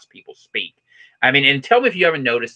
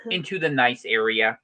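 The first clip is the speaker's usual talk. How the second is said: higher, punched announcer delivery with pauses; mock news report; 6 semitones higher than usual. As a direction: read it like a hammed-up local newscaster.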